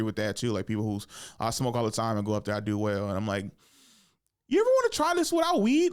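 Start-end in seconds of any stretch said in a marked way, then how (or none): 4.5-5.9 higher, louder, faster mock challenge; a hammed-up dare to a fellow comic; teasing. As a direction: needle them playfully. Quoting himself teasing other comics.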